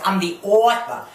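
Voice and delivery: AUTHOR stretched into "AW-tha", imitating her; mimicked pronunciation. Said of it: "as she says".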